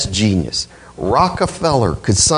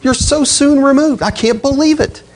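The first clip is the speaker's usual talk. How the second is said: rising, voice climbing high in disbelief; Paul scolding, pitch soaring higher; upset astonishment; 8 semitones higher than usual. Retold or imitated. Preacher voicing upset Paul to the Galatians, astonished and high. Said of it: "He is upset".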